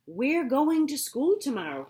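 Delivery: loud, high announcement; announcing. A narrator's reading. Farmer announces school trip to pigs, loud and high. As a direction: proclaim it to the pigs. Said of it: "he announced".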